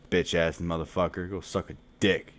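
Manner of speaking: normal talking voice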